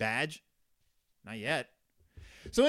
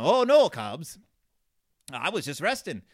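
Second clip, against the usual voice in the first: loud, swooping put-on farmer voice; Farmer Ben voice; 3 semitones higher, 8 dB louder than usual. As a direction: belt out the first cry, hammed-up farmer. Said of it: "He talks like that".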